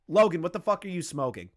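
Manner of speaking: ordinary talk, nothing marked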